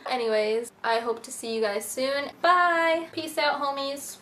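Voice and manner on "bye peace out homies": bright goodbye. Real, not acted. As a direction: sing out the farewell. Outro sign-off to viewers.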